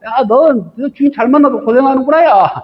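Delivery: a spoiled pet showing off to a friend; lightly voiced happy dog boasting; bragging dog. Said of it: "막 이러는 거예요".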